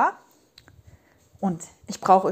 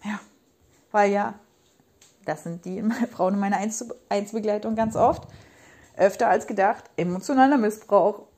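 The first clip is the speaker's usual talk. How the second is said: usual speaking voice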